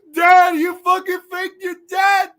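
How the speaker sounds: loud, high, slow mock wail; mock crying. Son confronting his father in a retold scene, mock crying. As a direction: bawl it out, hammed-up. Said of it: "He's, like, crying".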